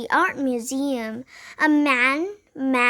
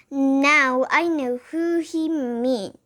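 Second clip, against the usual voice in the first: ordinary delivery, nothing marked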